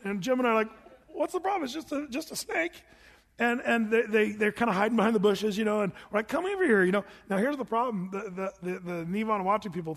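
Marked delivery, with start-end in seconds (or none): 1.1-2.8 high, light imitated nonchalance; mock innocent puzzlement. Preacher retelling himself and Jim asking scared guides, innocently puzzled. Retold reassurance to frightened guides. 6.3-6.8 raised-pitch coaxing call; imitated calling over. Preacher retelling calling guides out of the bushes, coaxing.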